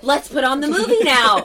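high, loud quote of an impatient friend; eager demand. Retold or imitated. Scratched-up friend wanting the movie on, eager loud demand.

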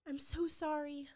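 high, crying-voiced apology; retold sobbing plea. Mother on the phone to birth mom, tearful high plea. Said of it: "I was sobbing, going".

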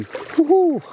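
high-pitched real whoop; high woohoo from chilly water; cold-water whoop. Real, not acted. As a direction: whoop at the cold on your feet.

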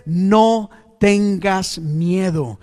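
very slow, strong preaching; emphatic proclamation. Acted. God telling the congregation not to fear, proclaimed slowly and firmly. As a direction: stretch each word as a firm command. Voices God's command to congregation.

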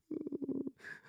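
high, soft whimper; imitated whimper. Retelling the nightmare, imitating the girl's whimpering. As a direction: mimic a little girl whimpering. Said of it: "like that, just whimpering".

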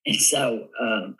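ordinary talk

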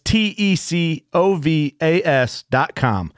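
slow, letter by letter spelling; spelled out slowly. Acted. Spelling out web address in ad.

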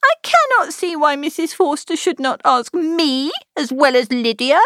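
high, peevish younger sister; whiny sister left out of the trip. A narrator's reading. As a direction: sulk it out, jealous and petulant. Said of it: "her accent was peevish".

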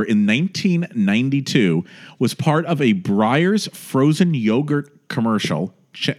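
ordinary talk, nothing marked

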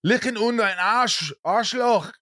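high-pitched, hammed-up German outburst; mock German cursing. Actor venting over his wasted preparation, hammed-up German cursing. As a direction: curse in German with theatrical, high-pitched frustration.

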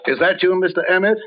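calling out. Acted. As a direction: call up the stairs. Calls toward someone in belfry.

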